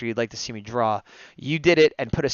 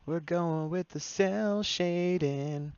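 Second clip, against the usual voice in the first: slow, drawn-out cartoon voice; goofy character voice; 5 semitones higher, 4 dB quieter, much slower than usual. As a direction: stretch it out like a cartoon hero. Goku voice bit about cell.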